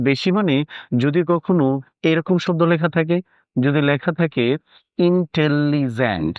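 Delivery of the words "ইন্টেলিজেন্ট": ইন্টেলিজেন্ট slowly drawn out syllable by syllable; slow syllable demonstration. Shown as a demonstration. Teacher asking students to count syllables, ইন্টেলিজেন্ট said slowly. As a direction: stretch ইন্টেলিজেন্ট so every syllable can be counted. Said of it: "এখানে কয়টা syllable বলো".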